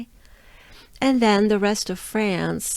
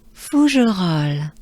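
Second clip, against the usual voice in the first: fou je roll louder in one breath; together, louder; 6 dB louder, slower than usual. Shown as a demonstration. Teacher leads learners saying it together loudly. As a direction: call it out with the class. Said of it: "Let's do it with me".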